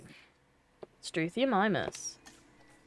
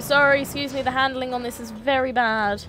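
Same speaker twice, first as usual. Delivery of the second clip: ordinary talk, nothing marked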